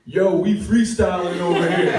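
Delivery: loud, high, laughing yell; shouted bro reply. Retold or imitated. Yelling back at the neighbor through the door, loud and cocky. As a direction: shout it back like a hype man. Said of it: "I go".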